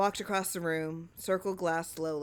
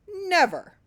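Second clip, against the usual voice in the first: emphatic; 12 semitones higher, 6 dB louder than usual. A narrator's reading. Emphatic reply, high and loud.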